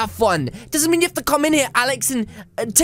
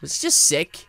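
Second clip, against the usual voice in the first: usual speaking voice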